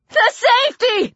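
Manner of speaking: high, piercing warning shriek. Acted. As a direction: shriek it out in panic. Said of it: "Tori shrieked".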